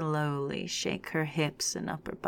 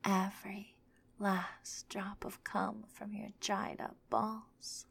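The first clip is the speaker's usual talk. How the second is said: breathy whisper; 3 semitones higher, 9 dB quieter than usual. Acted. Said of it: "in her breathy voice".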